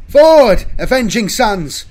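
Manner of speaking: loud, high rallying shout; battle cry. A narrator's reading. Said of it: "Velas cried".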